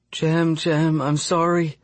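high, plaintive feverish murmur. A narrator's reading. Feverish patient mumbling to someone absent, high murmur. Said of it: "she murmurs".

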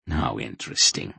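low, understated dry remark; dryly unimpressed. A narrator's reading. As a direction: observe it drily, pitch dropped. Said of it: "the Gorim observed dryly".

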